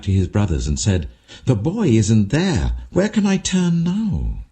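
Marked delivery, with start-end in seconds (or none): 1.4-4.5 high, slow, anguished question; Reuben's panic, pitched up and drawn out; distressed cry. A narrator's reading. Reuben finds cistern empty.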